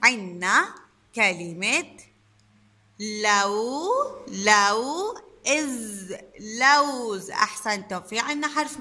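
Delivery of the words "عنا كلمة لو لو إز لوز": "لوز" split slowly into "لو" and "إز", then said whole; teacher modelling the syllables of "لوز"; sounding out. Shown as a demonstration. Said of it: "خلينا نشوف الكلمة اللي بعدها".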